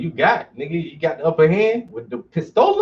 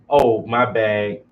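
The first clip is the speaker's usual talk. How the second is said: slow, offhand mock sorry; slow lazy "my bad" brush-off; fake apology; 6 semitones lower, 3 dB louder, much slower than usual. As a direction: drag out a careless excuse. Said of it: "and say".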